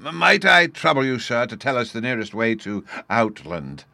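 high, loud opening plea, easing down; polite professor. Acted. Old professor asks a stranger the way to Outland, politely. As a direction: stammer into a courteous request, starting high.

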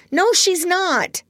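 put-on very high, drawn-out boyish refusal; slow, high-pitched contradiction; childish denial. A narrator's reading. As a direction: flatly refuse to believe her. Peter denies Wendy has a baby.

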